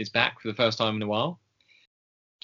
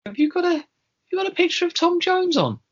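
imitating the woman, much higher and a bit louder; high-pitched woman quote; 4 dB louder than usual. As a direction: squeal it like an excited older lady. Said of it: "and she went".

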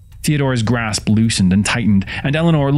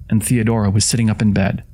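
ordinary delivery

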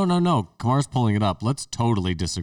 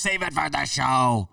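higher, drawn-out mock protest; imitated colleague's protest; 5 semitones higher, slower than usual. Imitating a colleague who refuses to talk, drawn-out protest. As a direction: stretch it out high, imitating him. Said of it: "puts both his hands up and he goes".

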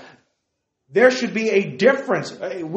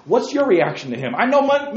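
ordinary delivery

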